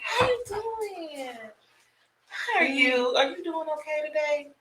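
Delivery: sweet, drawn-out cooing to an infant. Real, not acted. Baby talk to visiting infant.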